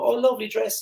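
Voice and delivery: "Oh, lovely dress" tossed off high and empty; an airy, throwaway flattery; mock shallow compliment. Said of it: "don't just go".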